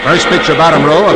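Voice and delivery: ordinary delivery, nothing marked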